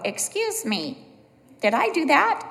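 singsong robot catchphrase, jumping high on the question; toy robot. Retold or imitated. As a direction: play a polite little robot. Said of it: "Robbie would sometimes bump into walls and go".

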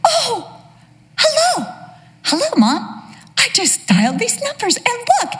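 put-on high, bright muddled old lady; confused elderly mother. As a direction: chirp it like a forgetful mom. Mother voiced, confused and chirpy.

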